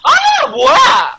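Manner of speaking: high, loud, drawn-out wail; alarmed scream. Real, not acted. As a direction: shriek in disbelief at the game. Screaming as the race goes wrong. (기겁해서 길게 비명 지르기)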